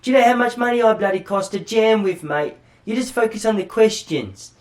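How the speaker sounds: high, loud British rock star voice; exaggerated British accent, indignant and emphatic. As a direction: ham up a British rocker telling someone off. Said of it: "he's British, isn't he?".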